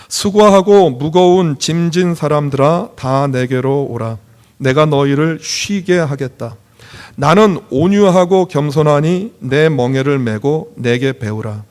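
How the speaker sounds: slow, measured reading aloud; solemnly paced, phrase-by-phrase recitation; scripture reading. Real, not acted. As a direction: lead the congregation through the verse. Leads congregation in scripture reading.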